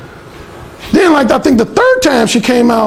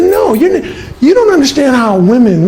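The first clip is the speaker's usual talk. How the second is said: higher mock woman's voice; protesting roommate; 5 semitones higher than usual. Alan imitating the roommate arguing back, high. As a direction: object in a raised girly voice.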